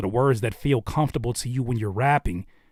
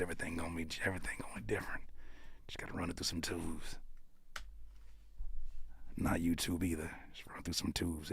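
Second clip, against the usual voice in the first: unvoiced, hushed guru whisper; mock mystic whisper; 8 semitones lower, 17 dB quieter than usual. Host whispering mix advice as a mock oracle. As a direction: breathe the secret like an oracle.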